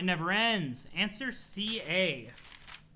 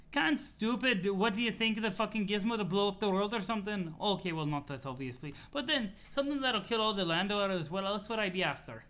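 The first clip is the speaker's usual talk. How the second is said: put-on exasperated troll in a silly accent, higher pitched; 3 semitones higher than usual. CA explaining the doomsday gizmo, exasperated accented voice. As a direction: snap back in a put-on accent. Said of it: "laughing at the C A voice".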